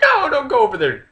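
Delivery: hammed-up fearful wife; worried wife. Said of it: "Wife's like".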